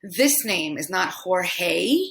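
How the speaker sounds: ordinary talk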